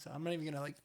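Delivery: ordinary delivery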